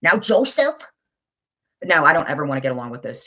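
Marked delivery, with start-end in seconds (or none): 0.0-0.8 "Joseph" lifted high with a rising end; mock another woman's upward lilt on "Joseph"; mimicking her "Joseph". Repeating a rival's way with a name, mocking mimicry. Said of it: "the way she says Joseph".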